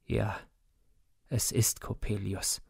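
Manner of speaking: quiet, faint and broken, a father resigned. A narrator's reading. Father to mother, faint and broken. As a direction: let the words come out weak and broken. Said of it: "mit matter, gebrochener Stimme".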